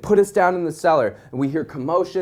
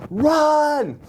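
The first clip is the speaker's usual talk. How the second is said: high urgent yell; shouted warning; 12 semitones higher than usual. Retold or imitated. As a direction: bark the order to flee. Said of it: "My guy goes".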